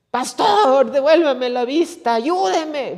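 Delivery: grito agudo y fuerte de ciego que pide auxilio; grito suplicante. Acted. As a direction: imita a alguien clamando a gritos por ayuda. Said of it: "usted no se va a poner a gritar". (grito de auxilio actuado)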